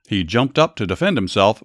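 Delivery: plain reading voice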